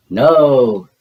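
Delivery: loud gleeful no; a loud playful cry of no; cried out. Acted. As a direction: call out the no triumphantly. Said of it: "cried the five witches".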